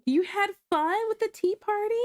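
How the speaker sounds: a mom asking her daughter about the tea party, high and sweet. Real, not acted. Asking her toddler about her day.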